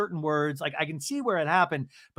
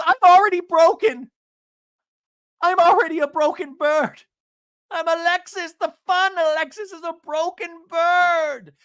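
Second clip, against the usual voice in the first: mock broken wail; 13 semitones higher, 9 dB louder than usual. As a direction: wail it like a hammed-up breakdown. Acting out Alexis's meltdown.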